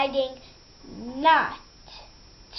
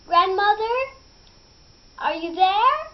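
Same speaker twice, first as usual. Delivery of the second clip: high, loud call through the door; a child calling at the door, higher and louder; 7 semitones higher, 5 dB louder than usual. Acted. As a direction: call out to grandma, raised and higher. Girl calls at grandma's door.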